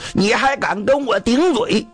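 high, affronted challenge to the wife; outraged. Acted. Husband cannot bear his wife talking back, outraged. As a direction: demand it, offended at being answered back. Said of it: "老太太一跟他顶嘴".

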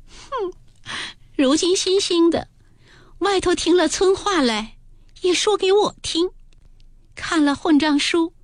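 high, tearful and hurt, speeding at the end; a wounded girl near tears; crying. Acted. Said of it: "黛玉便哭道". (委屈地哭诉)

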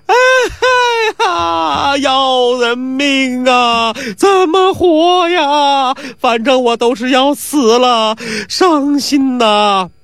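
high, slow, drawn-out wailing cry; mock wailing lament. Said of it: "这声音是越哭越高".

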